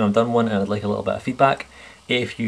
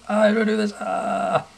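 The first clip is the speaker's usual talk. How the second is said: mock panic; 11 semitones higher than usual. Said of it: "I was like".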